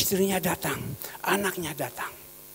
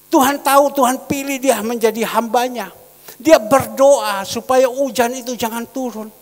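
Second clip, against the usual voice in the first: loud, raised, emphatic exhortation; full-force preaching; 8 semitones higher, 11 dB louder than usual. Acted. Pastor explaining Elijah's prayer to the congregation, forceful and loud. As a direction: drive each phrase home to the congregation.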